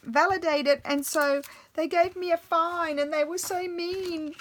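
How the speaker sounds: high, whiny retold excuse; mock teary daughter pleading; imitating tearful daughter. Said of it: "And she said".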